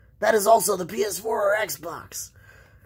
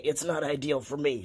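usual speaking voice

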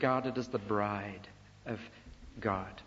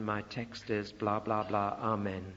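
mock polite sermon; 5 semitones lower, 5 dB quieter than usual. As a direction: drone through it like a dutiful polite preacher. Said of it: "not just a polite sermon".

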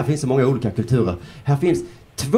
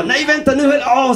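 high-pitched mock realisation; flip-flop in comic alarm; sudden second thoughts; 11 semitones higher, slower than usual. Debate viewer changing his mind, comically startled.

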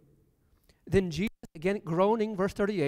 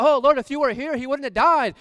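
pleading cry; 5 semitones higher, 7 dB louder than usual. Acted. Martha runs to Jesus pleading.

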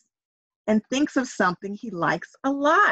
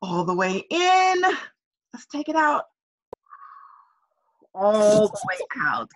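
breathing along demo. Shown as a demonstration. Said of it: "Do that with me, everybody".

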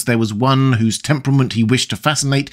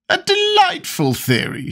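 much higher, slow delighted exclamation; exclaiming. A narrator's reading. The Duchess thrilled by Lord Henry's theory, delighted exclamation. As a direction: gush with delight, drawn out high. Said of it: "she exclaimed".

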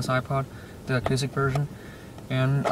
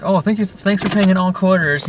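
higher-pitched imitation of a worker; joking cashier; 6 semitones higher, 4 dB louder than usual. McDonald's worker joking about quarters, higher mimicked thanks.

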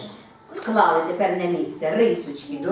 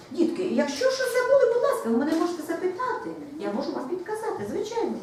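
sweet coaxing; 12 semitones higher than usual. Real, not acted. Teacher coaxing young pupils.

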